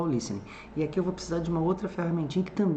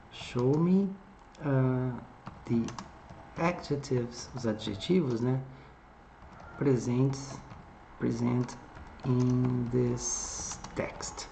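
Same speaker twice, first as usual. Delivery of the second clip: spelling out each English word slowly as it is typed; slow dictation of a typed prompt; much slower than usual. Shown as a demonstration.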